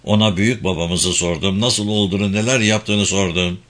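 ordinary delivery, nothing marked

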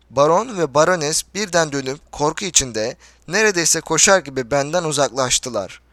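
ordinary delivery

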